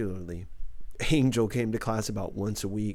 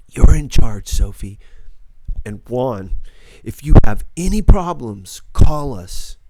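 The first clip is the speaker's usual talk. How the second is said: usual speaking voice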